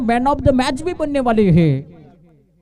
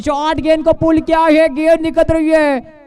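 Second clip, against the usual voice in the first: high, loud call of the pull; excited cricket commentary; 6 semitones higher, 4 dB louder than usual. Real, not acted. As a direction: shout it as it flies. Pulled shot going for six.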